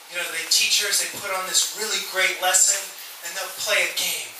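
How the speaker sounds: ordinary talk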